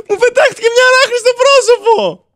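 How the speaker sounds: high, loud laughing shriek; shrieking laughter. Real, not acted. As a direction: squeal it through laughter after a scare. Hit by spider jumpscare, laughing. (τσιριχτό γέλιο μετά από τρομάρα)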